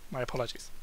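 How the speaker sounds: soft whispered aside; quiet whispered apology. Real, not acted. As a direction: whisper sorry after the cough. Aside after coughing.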